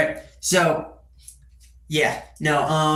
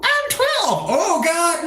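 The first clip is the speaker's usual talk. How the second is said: put-on kid voice; 13 semitones higher, much slower than usual. High kid voice answering.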